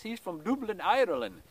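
a brief, hammed-up Irish accent; terrible Irish accent. Introducing a hiker from Dublin in a mock Irish accent. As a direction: put on a quick, bad Irish accent. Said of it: "I know that's a terrible Irish accent".

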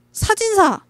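sudden high yelp of realization; surprised shout. Real, not acted. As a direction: blurt it out, startled.

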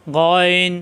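গইন voiced from the back of the throat, strong; heavy throaty গইন; throaty Arabic letter. Shown as a demonstration. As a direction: rasp গইন from the end of the throat. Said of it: "কণ্ঠনালীর শেষাংশ থেকে".

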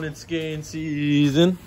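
ordinary delivery

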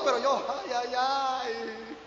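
soft laugh through the words. Real, not acted. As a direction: giggle it off. Laughing at being scolded. (riendo bajito)

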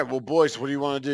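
ordinary talk, nothing marked

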